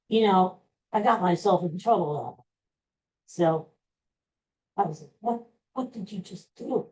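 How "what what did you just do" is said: ordinary talk, nothing marked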